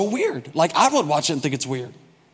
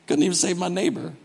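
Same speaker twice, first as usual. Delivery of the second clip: usual speaking voice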